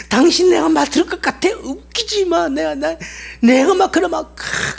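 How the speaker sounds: high, yelling defiance with the stress on 당신; loud scornful rant. Acted. Slave yelling at the master on the way home, scornful rant. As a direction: bellow your scorn at the master. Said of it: "막 욕을 퍼부어대도 막 고래고래".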